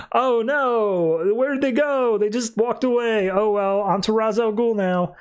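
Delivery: high-pitched mock dismay turning breezy; mocking dismissal. Mocks the story's nothing ending.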